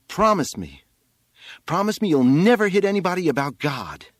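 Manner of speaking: high, loud demand to promise; insistent plea. A narrator's reading. Boy on the roof making his mother promise, loud plea. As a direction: plead at full voice, high and urgent.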